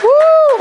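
high, loud "woo"; excited whoop. Real, not acted. Preacher asks who has it, then cheers excitedly. Cheers in answer to own question.

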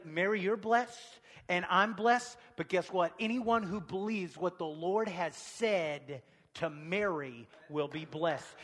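ordinary delivery

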